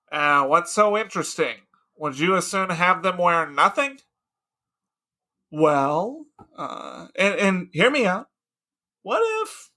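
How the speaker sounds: loud, coaxing, slowing pitch; sly Socrates. Acted. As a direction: ease the idea in slyly. Socrates pitching naked athletes.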